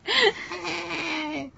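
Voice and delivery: very high, croaky "Hey" with a laugh; croaky cam girl voice. Retold or imitated. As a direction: squeak it out, laughing. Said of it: "so croaky".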